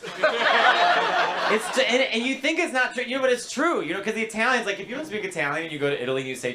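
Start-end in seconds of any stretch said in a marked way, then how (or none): none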